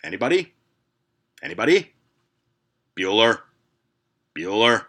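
droning teacher calling for an answer; a flat, bored teacher waiting for replies; monotone teacher roll call. Retold or imitated. Teacher calling out to a silent class, bored drone.

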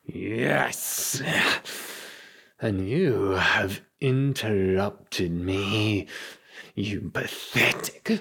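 seething, drawn-out vampire rant; enraged vampire villain. Acted. Game master voices vampire villain Miller.

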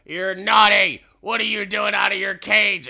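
loud, high mock telling-off; yelling scolding. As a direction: scold him like a misbehaving animal. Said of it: "he just starts yelling at him".

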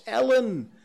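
high, loud shriek with tears in it; tearful shriek. A narrator's reading. Mother searching the crowd for her daughter, tearful shriek. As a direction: scream for the lost child. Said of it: "shrieked a woman in the crowd with tears in her voice".

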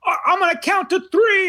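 high-pitched mock threat to the kids; parent yelling. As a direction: imitate a fed-up parent hollering in a store. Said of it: "just yelling".

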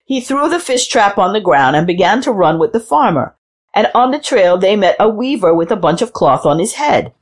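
ordinary delivery

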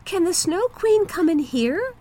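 put-on high, hopeful child's question; little girl voice. A narrator's reading. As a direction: ask like a wide-eyed little girl. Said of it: "asked the little girl".

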